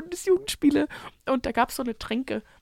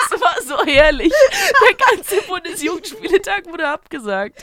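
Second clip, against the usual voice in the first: high, loud, laughing storytelling; laughing through words; 7 semitones higher, 9 dB louder than usual. Real, not acted. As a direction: tell it while cracking up. Erzählt lachend von der Katastrophe. (lachend erzählt)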